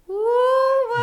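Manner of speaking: high, loud squeal of surprise; surprised woo. Real, not acted. Playful outburst after fumbling names.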